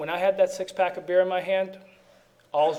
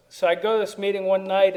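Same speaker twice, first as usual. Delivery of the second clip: ordinary talk, nothing marked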